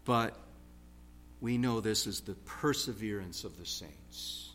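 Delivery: ordinary talk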